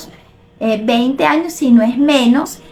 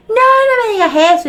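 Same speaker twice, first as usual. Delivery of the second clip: much higher, louder mock wail; imitating a hurt girl; 9 semitones higher, 3 dB louder than usual. Relative teased about weight, mock tearful protest. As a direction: play the teen girl, wounded and about to cry. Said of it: "y salió llorando".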